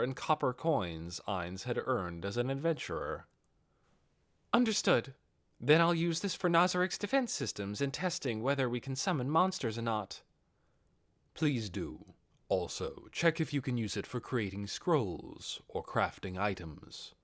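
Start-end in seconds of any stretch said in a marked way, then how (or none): 4.5-10.2 efficient secretary Albedo. Acted. 11.3-17.1 lightly lowered Ainz. Acted. Ainz instructs Albedo.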